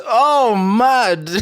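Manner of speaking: high, loud "oh, mad"; outraged disbelief. Real, not acted. Hearing his baby-niece excuse, loud high outraged disbelief. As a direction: explode in disbelief.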